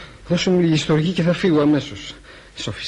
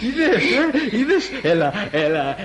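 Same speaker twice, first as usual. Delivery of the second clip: high, laughing teasing; tipsy giggling; 9 semitones higher than usual. Acted. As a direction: giggle while pouring the champagne. Drunk teasing with champagne.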